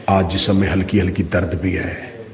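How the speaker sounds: hushed, low excuse-making; soft, low inner grumble; tempting inner voice. Acted. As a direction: murmur reluctant excuses to yourself. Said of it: "اگلی آواز پھر آئی".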